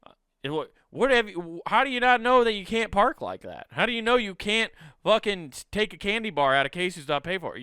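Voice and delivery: can't believe what you hear; incredulous disbelief. Retold or imitated. Him grilling someone who claims ignorance, incredulous. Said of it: "I'm like".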